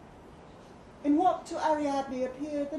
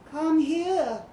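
higher, louder coaxing call; cajoling; 6 semitones higher, 8 dB louder than usual. A narrator's reading. Statue coaxing her distant lover to come. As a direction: coax him over sweetly. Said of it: "she cajoled".

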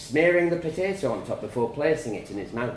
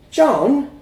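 alarmed call; 13 semitones higher, 13 dB louder than usual. A narrator's reading. Mother alarmed as he chokes.

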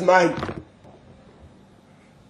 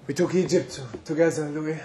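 quiet; 4 semitones lower, 9 dB quieter, faster than usual. Acted. Reminiscing with old friend Louis.

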